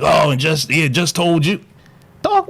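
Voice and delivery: normal talking voice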